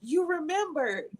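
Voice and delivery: a doctor lightly voiced, tender and knowing; gently voiced doctor. Doctor gently tells her tearful patient she remembered. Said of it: "and she was like".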